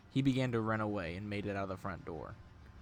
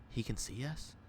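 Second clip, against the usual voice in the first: softer, questioning hush; hushed whispering; 3 semitones higher, 6 dB quieter than usual. A narrator's reading. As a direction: drop the volume as if whispering behind someone. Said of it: "Tom hears whispering coming from behind him".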